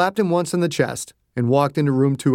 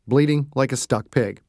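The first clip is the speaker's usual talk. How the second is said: ordinary delivery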